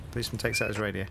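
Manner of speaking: usual speaking voice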